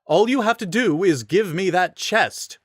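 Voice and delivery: high, haughty knight voice; smug pompous rider. A narrator's reading. Rider to Link, high haughty demand. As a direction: sneer the demand.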